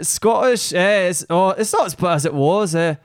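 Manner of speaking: put-on a hesitant, lightly done Scottish accent; a half-hearted Scottish accent, done on request. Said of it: "Do a Scottish right now".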